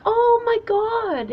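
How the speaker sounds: high, drawn-out awestruck gasp of words; amazed. Retold or imitated. Recalling her reaction to a stranger's birthday roses, amazed.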